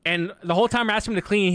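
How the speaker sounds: ordinary talk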